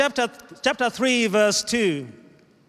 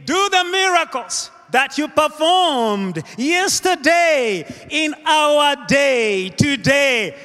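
higher-pitched, drawn-out plea; fervent preached prayer; 6 semitones higher, slower than usual. Acted. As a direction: call on God with a preacher's fervour. Prays at full force.

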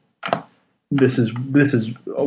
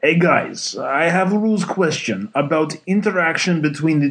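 put-on Russian accent; 2 semitones higher than usual. Podcast host reads a listener's question in a mock Russian accent. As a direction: read the letter as a hammed-up Russian. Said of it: "Do you want to read this in a Russian accent?".

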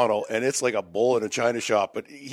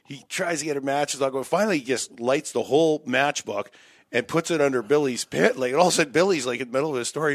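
ordinary talk

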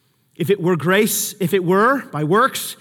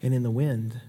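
softer, patient explaining; gentle correction; 5 semitones lower, 9 dB quieter than usual. Retold or imitated. God correcting Elijah's expectations, softly. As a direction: calmly set him straight. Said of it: "And God's like".

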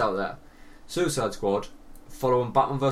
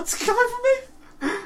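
very high squeal through laughter; laughing mock panic; 3 dB louder, faster than usual. To his co-host as the lights dim, laughing mock panic. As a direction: squeal it, cracking up.

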